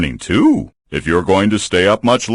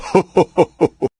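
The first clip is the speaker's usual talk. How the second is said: happy bear chuckle; 10 semitones higher than usual. Acted. Bear laughing at winning.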